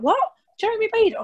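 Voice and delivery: high, puzzled echo of the name; baffled retelling. Retold or imitated. Producer baffled by the Jeremy Beadle question, high puzzled echo. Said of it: "And they're like".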